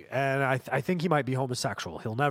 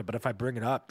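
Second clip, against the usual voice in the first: ordinary talk, nothing marked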